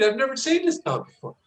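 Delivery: heightened alarm. Shown as a demonstration. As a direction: tell it as if the strange dog matters. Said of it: "I've just created a conflict".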